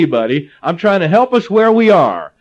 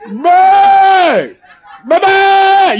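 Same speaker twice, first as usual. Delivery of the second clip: loud, high-pitched yells across the highway; teenage boys hollering at each other from cars; imitated yelling; 13 semitones higher, 7 dB louder, much slower than usual. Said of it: "they just yell at each other".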